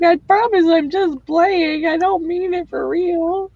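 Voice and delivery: high, drawn-out playful plea; high-pitched pretend apology; mock pleading. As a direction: plead innocence in a slow, high voice. Voices a plea to offended relatives.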